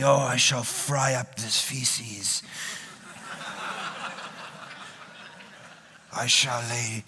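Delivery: very low, slow, solemn prophet voice; Ezekiel in first person. Retold or imitated. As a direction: intone it like an ancient biblical prophet, slow and deep. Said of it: "he is Ezekiel".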